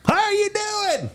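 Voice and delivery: very high, slow country cop voice; Roscoe the cop. Retold or imitated. Texas cop greeting the speeding driver, in a very high voice. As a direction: squeak it out like an old small-town sheriff. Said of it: "He sounded just like that".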